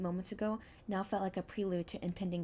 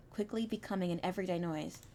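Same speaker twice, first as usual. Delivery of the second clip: usual speaking voice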